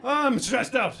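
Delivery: loud, high-pitched outburst; stressed out. Acted. Comedian confesses to the audience, stressed-out cry. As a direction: blurt it out under strain. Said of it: "I'm a stressed out individual".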